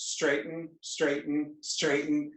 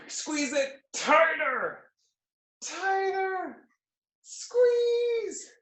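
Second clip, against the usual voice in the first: rising strained cue; 12 semitones higher, much slower than usual. Real, not acted. Urging class to squeeze harder.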